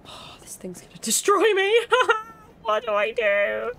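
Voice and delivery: high, loud wail breaking into laughter; loud, giggly dread; laughing panic. Real, not acted. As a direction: wail in panic, then crack up. Panicking at a game enemy.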